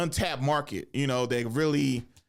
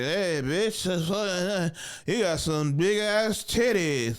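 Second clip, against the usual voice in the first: put-on higher, dragged-out drunken heckle; slurring drunk; 6 semitones higher, slower than usual. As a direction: imitate a drunk comic slurring. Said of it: "This motherfucker was slurring it".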